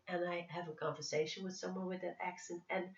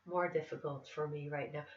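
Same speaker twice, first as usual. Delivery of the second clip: lilting Northern Irish with throaty R; sing-songy Irish accent. Shown as a demonstration. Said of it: "a pretty thick Irish accent".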